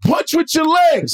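high, drawn-out mock shout; mock coach yell. Coaches correcting the novice boxer, imitated high yell. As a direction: imitate a coach hollering instructions slowly. Repeats coach's yelled instruction.

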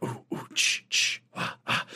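soft voiceless spooky breaths; whispered creepy noises. Retold or imitated. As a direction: breathe out eerie horror-movie sounds. Spooky movie sound effects.

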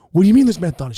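loud, high-pitched disbelief; acted indignant outburst; outraged demand. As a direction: yell the question in outrage. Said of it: "I look at her and go".

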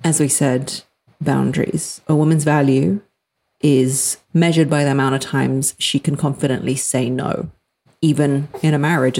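ordinary talk, nothing marked

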